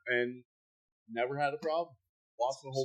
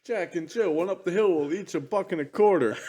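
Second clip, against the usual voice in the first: loud, brash comic delivery; a hint of Andrew Dice Clay; Dice Man voice; 6 semitones higher, 7 dB louder than usual. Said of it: "Dice Man".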